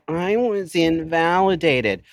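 high, slow whine; mock a self-pitying whine; whiny victim. Mocking a whiny complainer to listeners. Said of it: "sounds like a whine".

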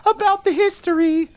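high, theatrical herald voice; grand announcement. Acted. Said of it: "pulls out his pipe and says".

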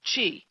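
Qi said alone as "chee"; Qi with a ch sound and long ee; correct Qi pronunciation. Shown as a demonstration. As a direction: say Qi clearly as "chee". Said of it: "The C H sound".

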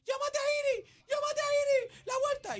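orden desesperada en voz agudísima, imitada; Fabi en pánico. Retold or imitated. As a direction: imita a la esposa pidiendo ayuda a gritos. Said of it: "Fabi se me tira encima". (chillido exagerado de urgencia)

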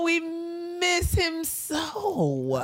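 mock mourning. Mourning Andre Leon Talley.

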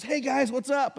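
lightly voiced confident stranger; imitating the bike guy. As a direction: bike man strolling up to the coffee table. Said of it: "and he goes".

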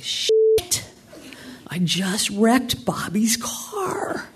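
high, drawn-out mock wail of dismay. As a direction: act out horrified realisation, dragging out the words. Retells dismayed reaction to the crash.

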